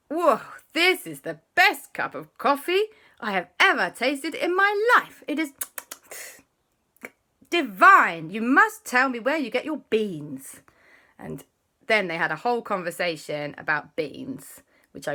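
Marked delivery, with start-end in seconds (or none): 0.1-10.6 high, loud, rapturous praise; delighted Papa. Acted. Papa raving to J B about the coffee, high and loud. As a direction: gush over the coffee like a connoisseur.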